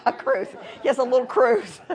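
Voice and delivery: loud, amused laughing agreement. Real, not acted. Teacher echoes a student's cruise joke, laughing. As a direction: laugh while going along with the joke.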